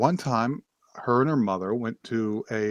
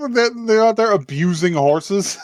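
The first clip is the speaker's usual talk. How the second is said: higher, incredulous question; higher mock shocked question; mock outrage; 8 semitones higher, 4 dB louder than usual. Real, not acted. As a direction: pretend to be scandalised. Mock outrage at story idea.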